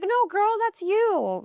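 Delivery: high, slow mock deflecting girl; deflecting a compliment. She deflects a friend's compliment, high mock girly voice. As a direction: push the compliment back in a high voice. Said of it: "I'd be like".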